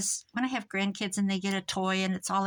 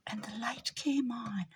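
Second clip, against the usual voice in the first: whisper; 5 dB quieter than usual. Real, not acted. Whispered aside.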